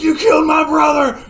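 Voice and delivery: high, very loud outburst; hammed-up cry of revenge; vengeful shout. Pip grabbing the gun over dead brother, shouting.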